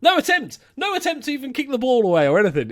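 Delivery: loud, high-pitched disbelief; incredulous outburst. Real, not acted. Gamer stunned there was no attempt to kick it, incredulous outburst. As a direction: protest loudly, voice shooting up.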